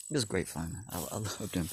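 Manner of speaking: ordinary delivery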